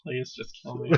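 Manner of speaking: very soft, resigned aside; defeated husband. Retold or imitated. Imitating husband dead behind the eyes, soft defeated plea. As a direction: say it quietly, dead inside. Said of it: "you can see the husband going".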